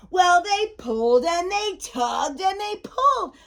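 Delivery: high, straining build to a climax; suspenseful rising storybook frenzy; mounting excitement. A narrator's reading. Final tug of war builds.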